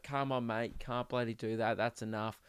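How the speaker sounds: a hint of a weary guard talking him down; male security guard. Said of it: "male security guard".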